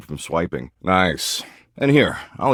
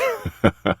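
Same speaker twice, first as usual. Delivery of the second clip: short, higher-pitched chuckle; amused chuckle; 5 semitones higher than usual. Acted. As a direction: chuckle to yourself at the post. Amused by doomscrolled posts.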